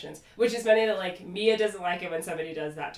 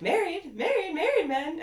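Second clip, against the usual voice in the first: high-pitched incredulous echo; Charisse; 10 semitones higher than usual. Retold or imitated. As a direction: mimic someone parroting words, pitched up. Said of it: "I think that was Charisse".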